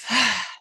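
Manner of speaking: sighing breath out through the mouth; audible exhale. Shown as a demonstration. Yoga teacher showing the class how to breathe out, audible sigh. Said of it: "out through the mouth".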